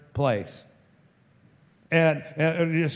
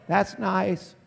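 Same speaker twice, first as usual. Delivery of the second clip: higher voice, unmoved by the shouting; wife's mild reply; 5 semitones higher than usual. Acted. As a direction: answer the big news with polite mildness. Mother's unimpressed reply to his shout.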